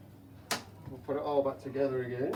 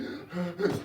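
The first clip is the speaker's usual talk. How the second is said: gasp; 2 semitones higher than usual. Acted. Fish gasping for air.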